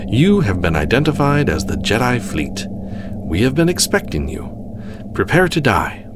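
crisply enunciated imperial threat; crisp, clear voice. A narrator's reading. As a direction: deliver a cool ultimatum over comms. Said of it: "a crisp, clear voice filled the bridge".

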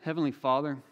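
unhurried prayer with long pauses; leading prayer. Real, not acted. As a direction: pray aloud for the congregation, phrase by phrase. Leads the congregation in prayer.

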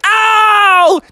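high, loud cartoon yelp of pain; acted howl after hitting spikes; long high shriek of hurt; pained yell. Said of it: "And Froggy was like".

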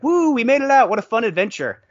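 loud, high-pitched mock cheer; giddy adventurer cheering in retelling; celebrating. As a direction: whoop it up as an imitation of gleeful relief. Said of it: "like, celebrating".

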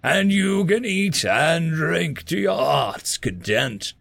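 slow, grand, booming welcome; old Norse king voice; booming old god. Acted.

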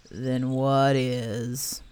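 low, very slow gloomy donkey voice. Acted. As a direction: mope the question out. Eeyore gloomy question.

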